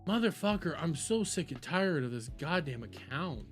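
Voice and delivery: usual speaking voice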